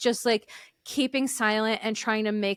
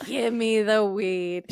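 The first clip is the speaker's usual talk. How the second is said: mock stoner; slower than usual. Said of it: "everyone's like".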